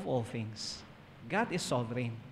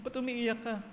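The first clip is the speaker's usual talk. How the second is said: high, softer mock whine; lightly mimicked tearful exchange, pitched up; crying child played lightly; 8 semitones higher, 4 dB quieter than usual. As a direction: act out a little one upset over spilled milk.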